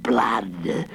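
slow, low menacing monster voice; boasting villain. A narrator's reading. The Hooded Fang threatening the rowers, boasting. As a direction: relish each word slowly, like a monster. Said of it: "boasted the Hooded Fang".

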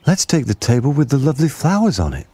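put-on high cheerful kid suggesting; child voice. A narrator's reading. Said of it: "said another".

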